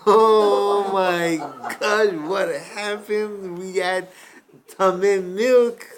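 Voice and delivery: loud, slow, high cry of alarm; horrified cry. Acted. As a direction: shout in horror at what you just drank. Vendors horrified at the tamei milk.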